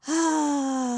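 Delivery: long exhale let out as a sigh; audible sigh. Shown as a demonstration. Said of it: "Let it be a sigh".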